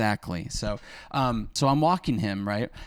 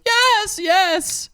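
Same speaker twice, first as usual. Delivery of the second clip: very high, slightly louder mock scream; imitated screaming woman; 4 dB louder than usual. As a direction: imitate desperate screamed answers. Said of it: "your impression of the woman screaming".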